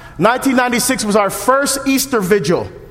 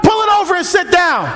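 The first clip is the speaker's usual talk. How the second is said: calling out; 9 semitones higher than usual. Acted. Voicing someone calling through door.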